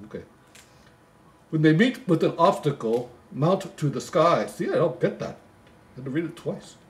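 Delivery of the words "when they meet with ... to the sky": poem recital. Acted. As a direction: read it like a bedtime Christmas poem. Reading the poem aloud.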